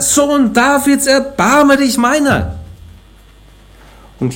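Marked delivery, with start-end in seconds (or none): 0.0-2.6 very high, slow, stretched-out plea; a higher, longer call for mercy; crying out even more. A narrator's reading. Blind Bartimäus crying out over the disciples, very high and slow. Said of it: "Er aber schrie umso mehr".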